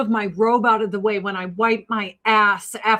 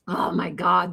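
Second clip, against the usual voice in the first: ordinary delivery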